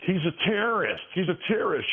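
high-pitched mimicked yelling woman; mock shrill accuser. Mimicking the protester woman shouting at him.

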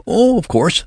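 high-pitched, flustered nurse; near-panicked high exclamation; hysterical cry. A narrator's reading. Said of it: "she cried hysterically".

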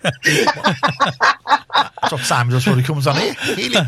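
slow laughing words. Real, not acted. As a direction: laugh through the line. Laughing joke about helium.

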